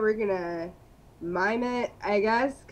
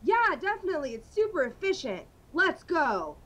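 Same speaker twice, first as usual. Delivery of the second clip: higher, louder cartoonish superhero dialogue; hammed-up superheroes; 5 semitones higher, 4 dB louder than usual. Batman and Robin shout about rabid squirrels with high excitement. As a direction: ham it up like a campy Batman serial.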